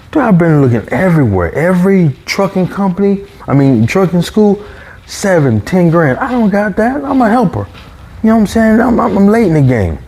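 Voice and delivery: high-pitched, worked-up excuses; retold exasperated protest. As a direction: plead your case up high, as if quoting yourself. Protesting retold excuses to fellow drivers.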